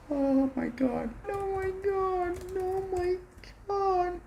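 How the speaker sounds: a thin delighted squeal; breathless excited squeak; high-pitched awe. Real, not acted. Stunned by new laptop.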